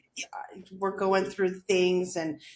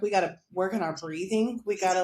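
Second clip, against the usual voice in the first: normal talking voice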